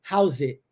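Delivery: "house it" with the S running straight into the vowel; "house it" said as one linked word; linked sounds. Shown as a demonstration. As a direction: join "house it" into one smooth chunk. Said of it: "eu vou unificar as duas coisas".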